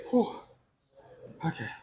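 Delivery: breathless after a workout; panting, catching breath. Real, not acted. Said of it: "I need to catch my breath".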